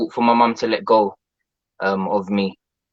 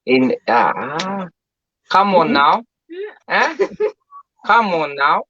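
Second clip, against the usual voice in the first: put-on African accent; 8 semitones higher, much slower than usual. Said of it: "that sounds Indian".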